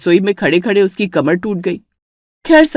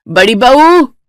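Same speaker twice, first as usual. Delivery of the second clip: louder call for the elder bride; raised household call; 4 semitones higher, 6 dB louder, slower than usual. Acted. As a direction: call her from the next room. Mother-in-law calls daughter-in-law.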